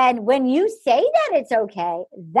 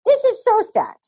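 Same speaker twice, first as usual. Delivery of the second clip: higher, whiny fake sadness; half-hearted imitation of sadness, pitched up; mock sad Juan Pablo; 9 semitones higher than usual. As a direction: ham up a hollow it makes me sad. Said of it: "And he goes".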